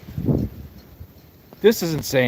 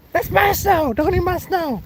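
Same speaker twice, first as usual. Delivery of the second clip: much higher, hammed-up squeaky scolding; mock possessive whine; 14 semitones higher than usual. To a neighbor kid eating his snow, high mock whine.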